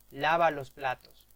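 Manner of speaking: loud, commanding order; firm order. Acted. Teacher demonstrating an order to a child, loud and commanding. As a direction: bark it as a clear command.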